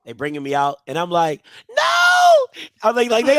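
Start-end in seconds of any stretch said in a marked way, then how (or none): 1.7-2.5 put-on very high, loud child's shriek; retold screaming. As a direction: shriek like a kid being dragged away. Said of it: "I'm screaming and yelling".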